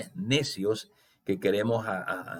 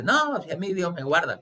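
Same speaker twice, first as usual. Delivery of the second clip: high, smug mock-pious voice; lightly voiced self-assured believer; pious excuse; 8 semitones higher than usual. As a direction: mimic a churchgoer brushing off a warning, pitched up. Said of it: "uno religiosamente dice".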